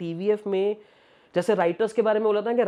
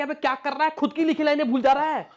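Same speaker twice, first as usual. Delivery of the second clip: higher, amused mock scolding; teasing scold; 7 semitones higher than usual. Director teasing the writer-actor who forgot his lines. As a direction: tease a forgetful actor. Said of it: "रुचि ने बोल दिया कि".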